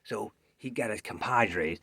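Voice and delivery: ordinary delivery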